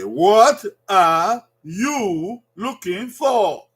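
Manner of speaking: "what are you looking for?" slowly, each word clear; slow teaching model. Shown as a demonstration. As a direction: slowly, model the question for learners.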